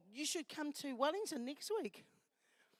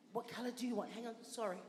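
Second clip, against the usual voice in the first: normal talking voice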